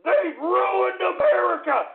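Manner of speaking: high, loud and slow, leaning on ruined; slow angry yell. Acted. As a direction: drag every word out in outrage. Ranting host repeats his accusation.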